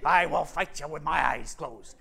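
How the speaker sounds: put-on lion voice, dropped back from a bellow; blustering lion. Lion caps his boasts to the travelers, easing off. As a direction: toss off the last boast in character. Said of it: "I love that scene where he says".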